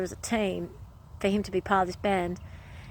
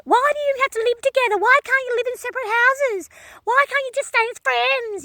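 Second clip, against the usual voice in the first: high, fast mock whine of a nagging sister; 14 semitones higher, 4 dB louder than usual. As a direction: parody her nagging in a squeaky pitched-up voice. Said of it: "she's crapping on like that".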